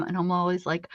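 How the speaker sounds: normal talking voice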